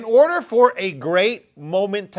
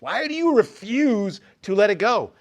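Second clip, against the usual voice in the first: usual speaking voice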